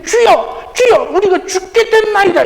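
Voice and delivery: high-pitched, complaining outcry; resentful cry. Acted. Disciples wake Jesus in the storm, resentful cry. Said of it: "어떤 불평, 원망, 이런 스럽게".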